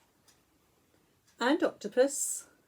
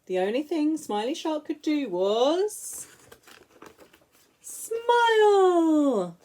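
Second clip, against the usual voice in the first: usual speaking voice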